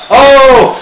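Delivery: sudden loud commentator gasp; excited oh. Acted. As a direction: react to the big mistake. Big foul in commentary.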